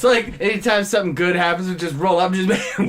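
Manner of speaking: ordinary talk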